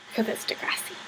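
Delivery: a conspiratorial whisper to the viewers; whispered aside. Real, not acted. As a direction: whisper it as a knowing punchline. Punchline aside.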